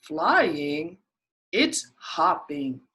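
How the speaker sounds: scoffing rabbit correcting. Acted.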